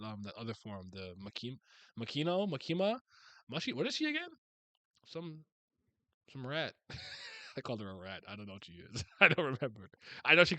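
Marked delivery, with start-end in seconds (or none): none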